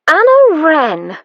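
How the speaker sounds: loud, drawn-out high call of the name. A narrator's reading. Felicity spotting Anna in the inn yard, calling loudly.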